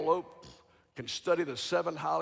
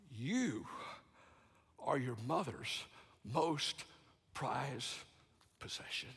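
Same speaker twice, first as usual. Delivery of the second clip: much softer, slow, ending in a whisper; tender father; 4 semitones lower, 9 dB quieter than usual. Retold or imitated. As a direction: tell him gently, word by word. Father's tender reveal, story climax.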